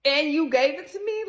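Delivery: high, loud retold outcry; mock distraught accusation. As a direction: act out a hurt accusation, pitch up. Replays tearful accusation of partner.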